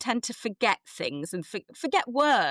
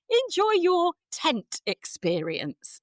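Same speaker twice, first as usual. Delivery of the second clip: high, slow 'Enjoy your tent'; odd phrasing; 8 semitones higher, slower than usual. Retold or imitated. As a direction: draw out 'tent experience' awkwardly. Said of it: "it came out as".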